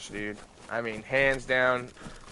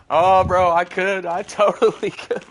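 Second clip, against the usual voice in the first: laughing excitedly; 6 semitones higher, 9 dB louder than usual. Real, not acted.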